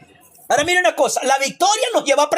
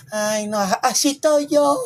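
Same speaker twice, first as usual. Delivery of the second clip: mock self-pity; slower than usual.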